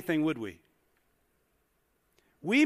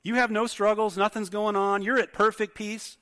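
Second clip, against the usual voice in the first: ordinary delivery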